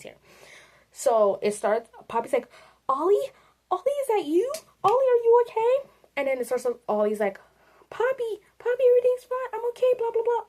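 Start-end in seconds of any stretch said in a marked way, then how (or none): 2.9-5.9 high-pitched, rising anxious call; worried Poppy. Acted. Poppy calling to Ollie to check he's okay, worried. 7.9-10.4 very high little put-on voice; squeaky reassurance rattled off as a sketch; Ollie. Ollie answering Poppy that all is fine, reassuring.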